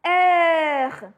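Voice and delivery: heure with a throaty rolled French R; French heure, R gargled at the back. Shown as a demonstration. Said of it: "you have to feel here the vibration".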